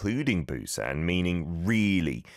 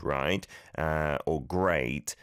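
normal talking voice

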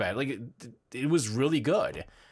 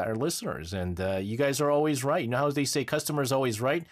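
ordinary delivery

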